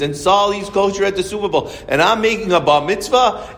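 a fed-up father piling on the complaint; irate caller. Retold or imitated. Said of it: "I have an irate guy screaming".

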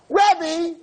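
high, loud shout across the hill; girl calling out. Retold or imitated. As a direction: call out to get his attention from behind. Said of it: "someone screams".

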